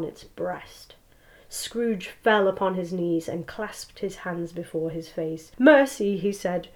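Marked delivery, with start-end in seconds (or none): 5.6-6.2 loud, high plea for mercy; terrified cry. Acted. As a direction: cry out in terror. Scrooge falling to his knees.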